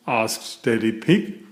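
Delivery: usual speaking voice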